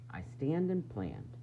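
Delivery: plain reading voice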